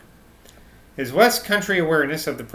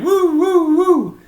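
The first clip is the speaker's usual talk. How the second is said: a much higher, louder wailing woo, like a dying man; high mock wail; 7 dB louder, much slower than usual.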